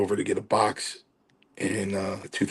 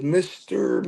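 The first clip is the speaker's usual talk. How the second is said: lightly voiced Chief, pitched up; Chief's greeting; 9 semitones higher than usual. Chief answering the phone, lightly imitated higher voice. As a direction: raise the pitch for a mock-formal greeting. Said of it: "he called me Mister Mancini".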